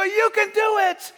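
high, loud crowd cheer; mock crowd yelling encouragement; crowd shouting. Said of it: "And they go".

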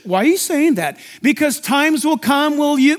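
ordinary talk

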